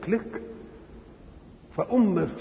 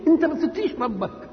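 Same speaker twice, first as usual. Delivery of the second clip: much higher, reproachful challenge; accusing reproach; 9 semitones higher than usual. Retold or imitated. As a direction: call out the wrongdoer. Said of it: "تعرض بواحد يكون ظلم حد". (استنكار ولوم للظالم)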